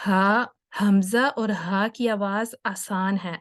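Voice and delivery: ordinary talk, nothing marked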